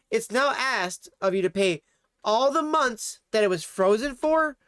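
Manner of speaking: ordinary talk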